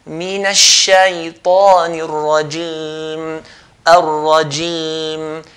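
"الرجيم" with a bright clear i, lips spread; clear kasra. Shown as a demonstration. Teacher drills the clear kasra for students. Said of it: "pronounce it with a clear kasra".

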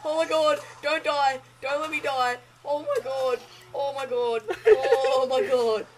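frantic high shouting that breaks into laughs; panicked screaming. Real, not acted. On fire, panicking.

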